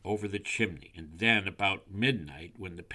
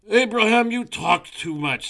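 high, loud scolding; scolding owl; 14 semitones higher, 12 dB louder than usual. A narrator's reading. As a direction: cut the chatterer off loudly.

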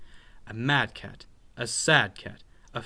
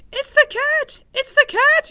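very high, loud mouse squeal; panicked cry; 13 dB louder than usual. A narrator's reading. Frightened little mouse warns his sister of the cat, panicked cry. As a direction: squeal the alarm at the top of your voice. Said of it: "he cried".

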